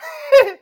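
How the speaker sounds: sudden high laughing burst; loud high laugh; burst of laughter. Real, not acted. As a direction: crack up loudly. Laughs at his own quote.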